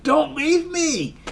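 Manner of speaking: high, drawn-out mock plea; mock desperate cry. Woman chasing after them, high imitated plea. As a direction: wail it out as she chases them.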